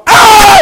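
loud, very high reenacted scream; sudden full-throated shriek. Husband gunning it over the cliff, screaming. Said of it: "I'm screaming".

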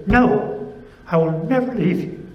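loud, high, forceful declaration; emphatic God. Acted. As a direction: pound the fist on the desk with every word. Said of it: "It's as if God is pounding his fist on his desk".

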